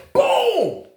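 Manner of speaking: loud high boom; punch sound effect. Retold or imitated. Narrator mimicking the punch, loud boom. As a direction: slam out the hit noise.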